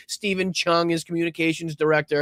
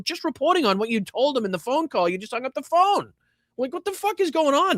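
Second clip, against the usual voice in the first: exasperated disbelief; 9 semitones higher than usual. Real, not acted. Host baffled by the circular phone-call reporting, exasperated. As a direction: pitch climbing high in incredulity.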